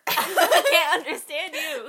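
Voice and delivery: slow, loud laughing complaint. Real, not acted. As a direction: say it through laughter.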